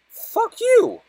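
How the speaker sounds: screamed very high and loud at the screen; real rage yell; furious shout. Real, not acted. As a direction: shriek it at the game. Yells at the game.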